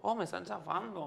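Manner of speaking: laughing "they're crushing me"; mock complaint. Shown as a demonstration. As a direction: giggle through a pretend squashing. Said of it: "y para que no sea solo como".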